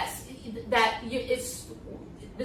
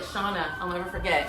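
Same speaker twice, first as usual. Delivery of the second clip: ordinary talk, nothing marked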